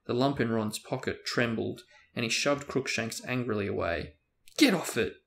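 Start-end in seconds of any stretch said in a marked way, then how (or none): 4.5-5.1 angry shout. Acted. Ron shoves cat angrily away.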